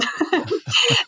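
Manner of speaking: high airy laugh; breathy laugh. Real, not acted. Laughing about getting teachers to sign a petition. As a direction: laugh at the memory.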